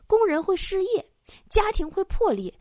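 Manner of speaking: high, mounting doom-laden list; mock-dramatic escalation. Friend's excuse for not quitting smoking, high mock-dramatic escalation.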